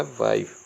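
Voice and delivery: वाइफ as one syllable; correct reading. Shown as a demonstration. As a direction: let वाइफ end on f, silent e. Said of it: "जो इसका सही उच्चारण है". (वाइफ का आख़िरी "ए" न बोलें)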